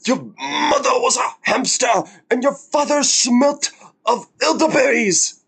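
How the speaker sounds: high, hammed-up taunting voice; French taunter insult. Streamer quoting the French taunter, high mock taunt. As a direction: jeer it like a smug castle guard.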